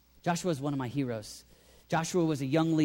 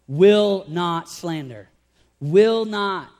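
emphatic; 9 dB louder, much slower than usual. Acted. Preacher emphasising firm refusal.

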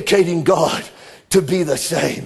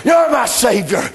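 raised, ringing preacher's call; full-force preaching; 4 semitones higher than usual. Acted.

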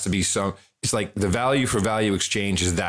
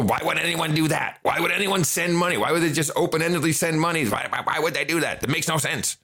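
higher, incredulous doubter voice; skeptical people; 7 semitones higher than usual. Retold or imitated. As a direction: play the puzzled skeptic. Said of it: "the first thing people".

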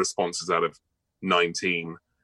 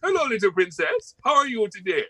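much higher, bouncy West Indian gardener voice for a cartoon; friendly West Indian cartoon gardener, pitched way up; 11 semitones higher than usual. Shown as a demonstration. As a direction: play a cheery West Indian gardener greeting the princess. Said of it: "the West Indian gardener who talks a bit like that".

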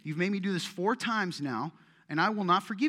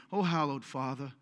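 lightly put-on formal praying voice; mock pious prayer; 4 semitones lower, 3 dB quieter than usual. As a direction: imitate someone praying piously, a touch lower. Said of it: "That's how you talk".

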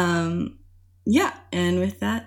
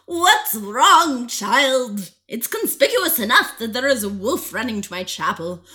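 mysterious voice; 4 semitones higher, 5 dB louder than usual. Acted. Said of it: "A voice came from the shadows".

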